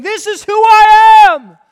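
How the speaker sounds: loud, high proclaiming shout; booming divine proclamation; God shouting. Acted. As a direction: shout it out as God revealing himself. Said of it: "Jesus is God shouting to us".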